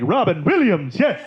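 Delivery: high, swooping showman's announcement; manic Robin Williams. Acted. Comic playing the unpredictable star at full energy, manic. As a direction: burst out with hammed-up exuberance. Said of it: "you never know if he's gonna be like".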